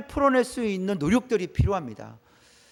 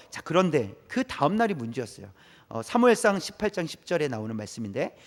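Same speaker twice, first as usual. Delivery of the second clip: usual speaking voice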